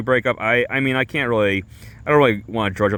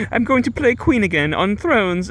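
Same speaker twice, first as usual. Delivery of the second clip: high, chipper mock-British woman; badly faked British accent; 12 semitones higher than usual. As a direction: gush in a high, put-on British voice. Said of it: "I can't do a British accent".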